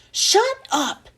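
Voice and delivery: high-pitched, exasperated mild yell. A narrator's reading. Teacher silences the class a third time, yelling. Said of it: "Missus Jules yelled a third time".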